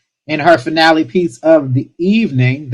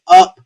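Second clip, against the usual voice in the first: the word up jumps much higher and louder; lifted up; 6 dB louder than usual. Acted. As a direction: sing out up as if lifting the room. Host urging poets to lift up.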